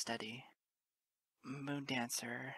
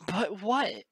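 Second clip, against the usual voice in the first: higher, much louder sobbing cry; sob; 5 semitones higher, 9 dB louder than usual. Acted. Moon Dancer cutting Lyra off, sobbing. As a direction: sob the question out. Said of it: "Moon Dancer sobs".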